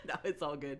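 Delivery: ordinary delivery, nothing marked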